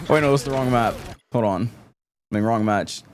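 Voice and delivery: ordinary delivery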